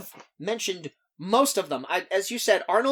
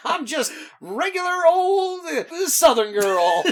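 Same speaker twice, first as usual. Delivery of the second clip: mock Southern accent; 4 semitones higher, 4 dB louder than usual. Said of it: "I'm harping on her accent".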